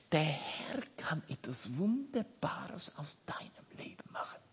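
hushed, breathless whisper. Acted. Preacher whispering hope to a listener, intimate whisper. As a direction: lean in and whisper the promise. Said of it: "whispered promise to listener".

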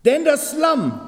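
high, loud ringing start; joyful favorite verse; excited proclamation. Real, not acted.

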